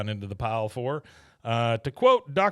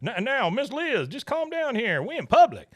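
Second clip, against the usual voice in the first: very high-pitched Southern placating voice; Buck; 11 semitones higher, 3 dB louder than usual. Retold or imitated. Husband tries to calm wife in public, high flustered voice. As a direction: push the pitch way up, getting louder at the end. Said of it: "Buck stands up real quick and goes".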